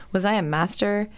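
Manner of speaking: ordinary delivery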